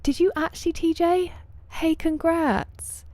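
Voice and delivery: high, delighted disbelief; thrilled for a friend's big news; excited surprise. Real, not acted. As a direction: squeal it up in happy surprise. Chat member's news, excited greeting.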